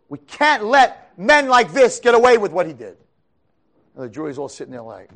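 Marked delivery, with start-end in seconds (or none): 0.1-3.0 loud, higher, slow demand; prosecutor's closing demand. Retold or imitated. Prosecutor finishing his plea to the jury, slow and loud. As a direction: hammer home the final plea.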